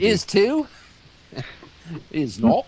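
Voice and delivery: put-on high, childish back-and-forth; mock bickering. Friends teasing a touchy pal, childish bickering. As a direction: tease like a playground squabble.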